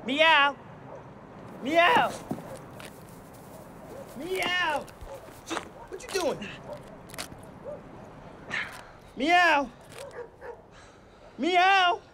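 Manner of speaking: a man meowing like a cat to get attention. Acted. Cat suit guy meowing up at Susie. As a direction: call out a mock meow. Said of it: "I'm trying to get Susie's attention".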